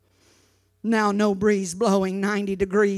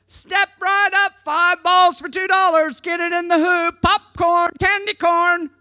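higher, louder sing-song barker call; carnival barker; 7 semitones higher, 5 dB louder than usual. Pretend. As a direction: hawk the games to the passing crowd. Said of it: "I did that pretty good".

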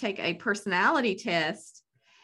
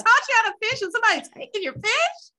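fired-up; 12 semitones higher, 6 dB louder than usual. Retold or imitated. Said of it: "now I'm like".

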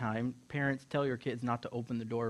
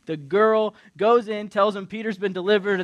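ordinary talk

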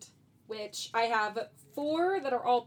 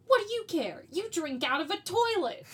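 high-pitched, louder cartoon retort; Shaggy; 7 semitones higher, 4 dB louder than usual. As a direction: throw it back in a pitched-up character voice. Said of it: "and Shaggy just goes".

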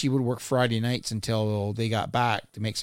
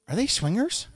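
mock innocent question; 11 semitones higher than usual. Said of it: "I'm like".

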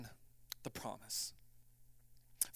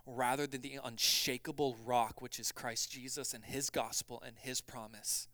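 ordinary talk, nothing marked

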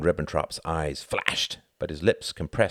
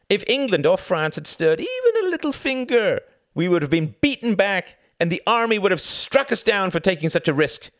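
much higher, louder scornful voice; mocking envoy; 6 dB louder than usual. A narrator's reading. Envoy mocking Churchill, high and scornful. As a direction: scoff at him. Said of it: "Von Ribbentrop laughed".

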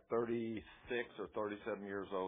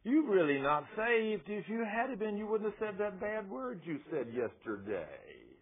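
higher, slow mocking devil voice; devil taunting; 10 semitones higher, 4 dB louder, slower than usual. Devil taunts the believer, higher mocking voice. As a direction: sneer like the accuser. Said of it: "the devil comes up to you and says".

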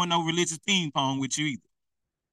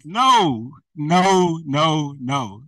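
loud, drawn-out repeated no; emphatic refusal; 2 semitones lower, 6 dB louder, much slower than usual. Real, not acted. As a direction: shut it down loudly. Rejecting the faraway church claim.

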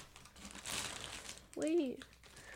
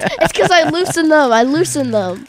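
loud, high, indignant protest; 9 semitones higher, 13 dB louder than usual. Real, not acted. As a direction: insist loudly that you loosened them. Defending after being teased.

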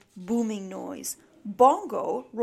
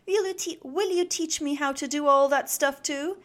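high, louder, eager question; beaming pride; 5 semitones higher, 5 dB louder than usual. A narrator's reading. Snip asking Dad to teach him, beaming proudly. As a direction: ask brightly and proudly. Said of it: "beaming proudly".

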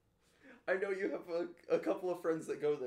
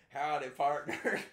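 slow, put-on Southern drawl; mock Southern twang, on the edge of laughter; exaggerated Southern accent; 5 dB louder, slower than usual. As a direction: ham up a fake Southern cowboy greeting. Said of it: "accentuating his southern accent".

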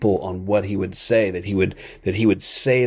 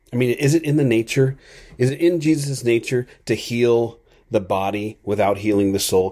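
ordinary talk, nothing marked

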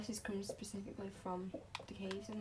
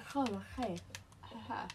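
ordinary talk, nothing marked

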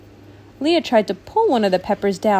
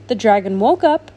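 ordinary delivery

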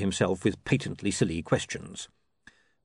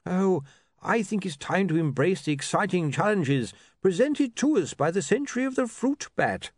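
a doddering academic spelling his name; fussy old wizard; 10 semitones higher than usual. Acted. Bursar speaks.

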